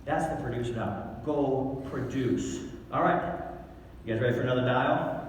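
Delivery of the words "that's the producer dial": ordinary talk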